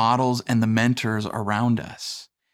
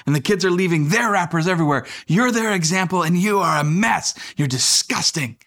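higher, fast mock rant as wife; wife scolding; 6 semitones higher than usual. Wife listing the kids' mess to husband, mock exasperated. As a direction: play the exasperated wife, lightly. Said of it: "she's like".

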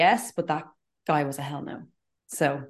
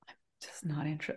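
hushed, breathy inner voice; whispered thought; 2 semitones lower, 13 dB quieter than usual. Retold or imitated. Retelling her reluctance to a colleague, whispered.

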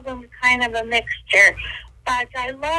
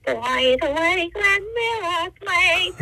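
high, slow warbling housewife voice; slow, high sitcom housewife impression with a catch in the voice; Edith Bunker impression; 6 semitones higher, slower than usual. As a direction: croon it slowly up high like a TV housewife. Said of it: "Edith Bunker".

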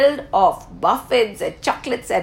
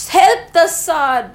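plain reading voice

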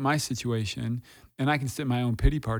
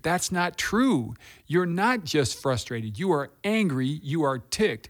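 ANGRY and TICKED landed slowly and hard; firm correction; 4 semitones higher, slower than usual. Retold or imitated. Counselor correcting a client in denial, firm and emphatic. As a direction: spell it out firmly, leaning on "true", "angry" and "ticked".

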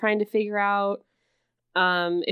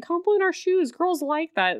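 higher-pitched imitation of a daughter; 9 semitones higher than usual. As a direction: lightly mimic the daughter, pitched up. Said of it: "his daughter's like".

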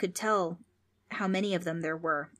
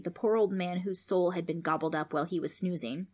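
ordinary delivery

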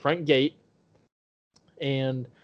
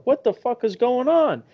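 shocked disbelief; 10 semitones higher, 5 dB louder than usual. Retold or imitated. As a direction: re-enact alarm, high and loud. Retold shock at being slapped.

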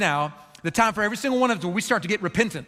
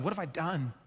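much softer, hushed self-reproach; remorseful murmur; 2 semitones lower, 11 dB quieter than usual. Retold or imitated. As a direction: barely voice it, full of remorse. Father regrets losing his temper.

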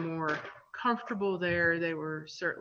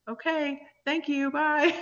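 much higher, bright quick bye; chirpy mock goodbye; 10 semitones higher, 4 dB louder than usual. Vet waving off the hugging snake owner, high flustered goodbye. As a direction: sing out a flustered thank you and bye.

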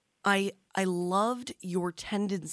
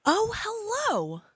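surprised; slower than usual. Real, not acted. Host greets guest on line.

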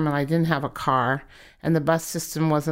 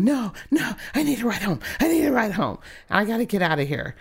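panicked pleading; 5 semitones higher than usual. Retold or imitated. Retold inner panic, wants to leave.

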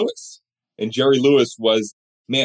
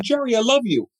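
much higher, faltering declaration; nervous fan; 11 semitones higher than usual. Retold or imitated. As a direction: stammer it out starstruck. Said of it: "I was so nervous".

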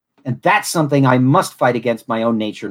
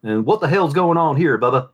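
Southern accent. Shown as a demonstration. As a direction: play it like a good old boy. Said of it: "between, well".

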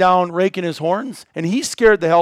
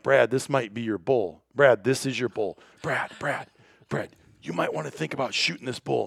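much softer, lower urgent hush; hushed urging; 5 semitones lower, 9 dB quieter than usual. Retold or imitated. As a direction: press him quietly, don't spook the bull. Urging Brad quietly near the bull.

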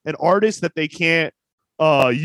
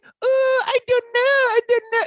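very high, mumbly Miss Swan voice; much higher, dopey mock accent; Miss Swan impression. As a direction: squeak it out like the Mad TV character. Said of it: "It's like Miss Swan".

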